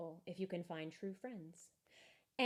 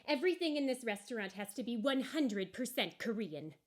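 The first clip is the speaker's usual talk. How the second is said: higher, louder, snooty owner turning someone away; stern restaurant owner; 5 semitones higher, 5 dB louder than usual. Acted. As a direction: shoo the visitor off, proudly.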